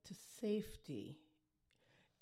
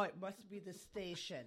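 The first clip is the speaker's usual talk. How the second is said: ordinary talk, nothing marked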